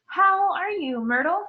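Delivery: high, forced cheerful greeting; hammed-up false cheer; falsely bright. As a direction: fake brightness to placate. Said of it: "in a falsely bright voice".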